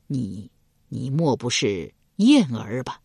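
low, slow, halting recognition; 迟疑试探地问; tentative question. Acted. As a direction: ask carefully, barely daring to believe. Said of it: "试探着问道".

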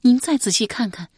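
少女口吻. Acted. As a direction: coax him to look closer. Yan'er urges the king.

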